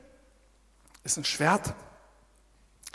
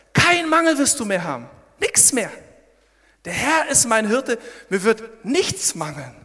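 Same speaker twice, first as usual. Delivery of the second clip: fervent preaching; 5 semitones higher, 6 dB louder than usual. Acted.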